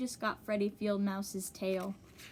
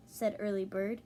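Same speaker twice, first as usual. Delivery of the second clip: plain reading voice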